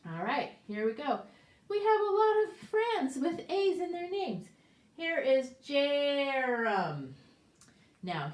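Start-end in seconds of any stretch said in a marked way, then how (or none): 1.7-7.1 much higher and louder, upbeat; bright and excited. Real, not acted. Teacher cheerfully restarts the lesson. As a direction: perk up for the next set.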